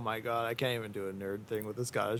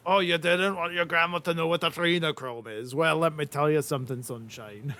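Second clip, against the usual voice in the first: higher, hammed-up taunting voice; raised, needling character voice; taunting retort; 4 semitones higher than usual. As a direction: jump in with a mock challenge. Plays along as a taunting character.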